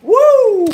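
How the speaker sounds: whooping cry, high and loud; loud whoop after laughing; loud high woo. Real, not acted. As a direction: let out a big high whoop. Exclaims after laughing fit.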